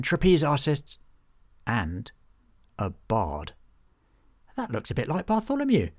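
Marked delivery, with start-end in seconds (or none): 4.5-5.9 much higher, trailing goblin voice; small goblin voice. Acted. Hobgob the goblin staring at the poster, high and trailing off. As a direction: let the goblin's voice trail away. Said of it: "Hobgob's voice trailed off".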